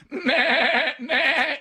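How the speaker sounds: high quavering baa; sheep bleating. Retold or imitated. Traveler showing off his farm animal protest, bleating. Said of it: "I'm doing the sheep".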